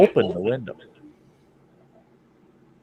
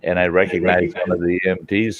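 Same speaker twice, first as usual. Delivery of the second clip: usual speaking voice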